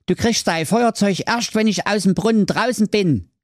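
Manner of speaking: high-pitched hero refusing firmly; wary Schwerthelm. A narrator's reading. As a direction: set your condition in a high character voice. Hero answers the witch. (misstrauischer Held, hohe Stimme)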